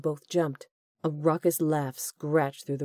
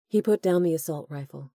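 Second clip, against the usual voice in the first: ordinary delivery, nothing marked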